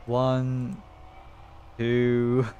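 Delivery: ordinary delivery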